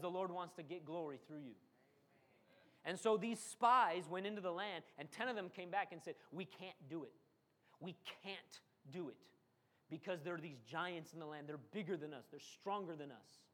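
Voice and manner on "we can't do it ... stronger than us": voice of fear. Retold or imitated. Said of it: "Fear has a voice".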